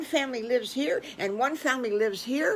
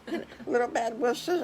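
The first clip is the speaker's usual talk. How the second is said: ordinary delivery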